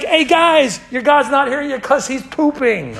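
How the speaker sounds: high-pitched playground heckle; gleeful taunt. Retold or imitated. Elijah mocking the prophets as a kid hears it, gleeful taunt. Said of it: "It's like".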